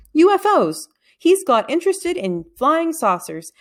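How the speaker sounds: eager. A narrator's reading. Father proudly remembering.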